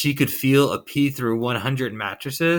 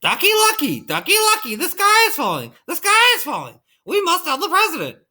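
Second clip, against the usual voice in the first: very high, frantic chicken voice; clucking hen; 14 semitones higher, 3 dB louder than usual. A narrator's reading. Chicken Licken warning her friend Ducky Lucky, clucking. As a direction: squawk it out way up high in alarm. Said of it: "to her friend Ducky Lucky and clucked".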